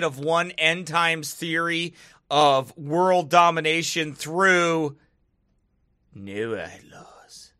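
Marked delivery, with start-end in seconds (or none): 6.1-7.5 low, hushed, spooky whisper; hammed-up secretive hush; mock conspiracy whisper. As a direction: drop suddenly into an ominous whisper. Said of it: "every other conspiracy that I have to say like that".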